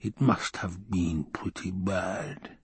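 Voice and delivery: slow, hushed inner thought; private musing. A narrator's reading. Baron guessing at the hidden losses, hushed thought. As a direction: think it softly and slowly.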